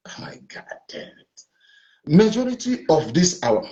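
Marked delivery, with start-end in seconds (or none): 0.1-1.4 exasperated whisper, barely audible; whispered aside. Real, not acted. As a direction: breathe it out in frustration to yourself. Frustrated aside after the argument.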